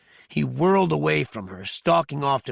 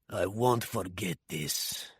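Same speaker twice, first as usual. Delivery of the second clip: low, soft, slow eerie promise; a chilling quiet vow of revenge; 6 semitones lower, 8 dB quieter, slower than usual. A narrator's reading. Osirov releasing Tracy with an eerie threat. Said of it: "he said in an eerie tone".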